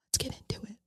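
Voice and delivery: breathy whisper. Real, not acted. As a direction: whisper it conspiratorially. Whispered segue into the topic.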